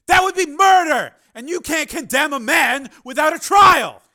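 very loud, high and slow shout; furious shout. Acted. Engineer shouting at the senator, furious. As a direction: roar it, jumping up. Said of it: "Higgins shouted".

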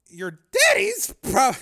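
high, loud, slow mockery; derisive. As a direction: sneer the words out slowly. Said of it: "he said with derision".

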